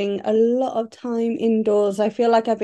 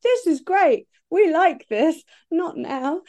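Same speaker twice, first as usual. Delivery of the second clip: lifted, higher voice for the quote; high quoting voice; 7 semitones higher than usual. Retold or imitated. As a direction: pitch the quote up well above your own. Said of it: "who are like".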